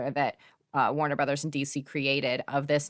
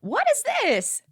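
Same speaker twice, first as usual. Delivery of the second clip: mock shriek; 3 dB louder than usual. Mock disbelief at horror director.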